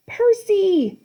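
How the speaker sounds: loud, high call. A narrator's reading. A friend calls Percy in danger, loud and high. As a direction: shout his name. Calling out.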